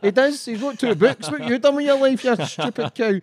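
high-pitched, hammed-up outrage on a friend's behalf; mock indignant telling-off. Host scolds his guest's online critic for him, mock indignant.

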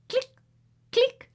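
click sounds. Retold or imitated. Imitated button clicks.

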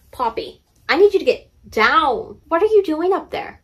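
calling to pet. Real, not acted. Calling to the cat Poppy.

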